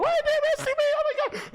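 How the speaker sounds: mock her panic in a squealing falsetto; freaking out. Girlfriend panicking at her arrest, high mocking wail. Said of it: "she starts freaking out".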